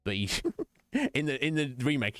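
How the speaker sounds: normal talking voice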